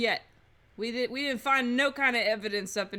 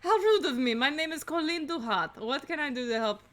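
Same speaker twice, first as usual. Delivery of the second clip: put-on French accent. Housemaid introducing herself to officers, French accent. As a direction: put on a French accent, then lose heart. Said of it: "I hate doing a French accent".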